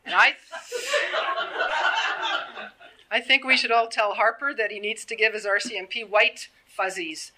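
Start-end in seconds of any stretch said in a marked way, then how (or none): 0.4-2.9 high breathy burst of laughter; a real laugh at the absurdity; incredulous laugh. Real, not acted. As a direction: laugh it off in disbelief. Laughing at the undercover claim.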